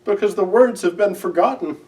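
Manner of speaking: high, tearful storybook owl; a touch of tears, voice lifted high; sad Owl voice. A narrator's reading. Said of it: "Owl began to cry".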